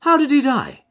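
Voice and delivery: keen detective to his friend; Holmes voice. Acted. Holmes to Watson on the train, eager and keen.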